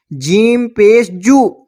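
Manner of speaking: जीम पेश जू spelled very slowly, ending on a long rounded जू; slow spelling drill. Shown as a demonstration. Teacher spelling for students, very slow drill ending on जू. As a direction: drag each part out like a reading drill. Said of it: "इस पर पेश लगाते हैं".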